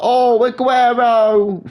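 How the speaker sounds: high, long-held mock goal call; imitating everyone shouting a famous commentary line; mock commentator shout. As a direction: mockingly wail the name out slowly and high. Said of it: "everyone keeps fucking shouting".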